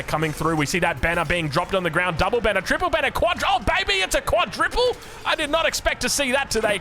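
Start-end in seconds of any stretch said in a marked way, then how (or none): 2.2-6.8 rising, high-pitched hype; excited commentary. Real, not acted. Caster reacting to a quadruple banner drop, excited. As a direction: build to amazed play-by-play excitement.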